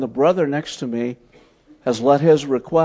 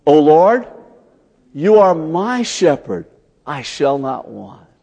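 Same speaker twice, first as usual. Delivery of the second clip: slow, loud, higher call of O Lord, then settling; 1 leading group prayer; 4 semitones higher, 6 dB louder, slower than usual. Shown as a demonstration. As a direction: lead the room in prayer, slow and full. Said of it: "Why don't you say that with me?".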